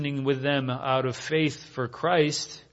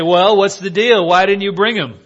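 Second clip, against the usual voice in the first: loud, higher-pitched acted indignation; exasperated, raised-voice challenge; indignant demand; 5 semitones higher, 8 dB louder than usual. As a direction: demand an explanation from your underlings. Acting out indignant Pharisees demanding answers.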